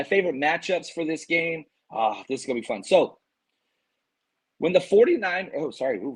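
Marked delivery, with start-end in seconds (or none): 4.6-5.5 real crack from a strained voice. Real, not acted. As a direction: voice cracks mid-phrase from hoarseness. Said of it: "voice crack".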